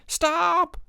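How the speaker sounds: high, loud shout. A narrator's reading. Counselor shouting running to the dock. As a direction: yell it after the boat.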